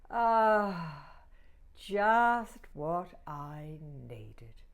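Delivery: very slow relieved ah; contented sigh. Acted. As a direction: savour the first sip. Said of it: "she sighed".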